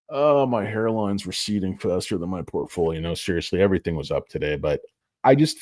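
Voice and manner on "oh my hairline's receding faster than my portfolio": usual speaking voice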